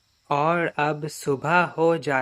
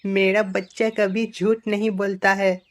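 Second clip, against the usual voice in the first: higher, emphatic mother character; alarmed mother; 6 semitones higher, 4 dB louder than usual. Acted. Mother believes her child and will warn everyone, alarmed. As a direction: indignantly defend the child, pitched up.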